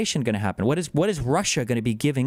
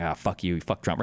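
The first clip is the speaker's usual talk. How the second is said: lower, softer grumbling brush-off; mock grumble; 8 semitones lower, 4 dB quieter than usual. Grumbling liberal brushing off a gloating conservative, mock sulky.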